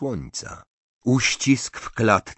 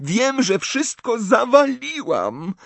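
very high, drawn-out wail; hysterical cry; 4 dB louder, slower than usual. A narrator's reading. Hermiona declares she failed everything, crying hysterically. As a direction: wail in despairing panic. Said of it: "machając histerycznie rękami".

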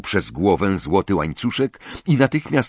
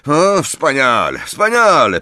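loud, higher sarcastic exclamation; angry; 6 semitones higher, 5 dB louder than usual. A narrator's reading. Ron reacts to the locket plan, angry. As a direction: burst out in exasperation. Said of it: "powiedział ze złością Ron".

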